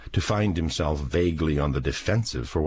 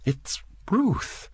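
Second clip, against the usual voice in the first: frightened. Acted. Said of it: "she said again".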